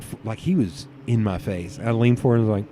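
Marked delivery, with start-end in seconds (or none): none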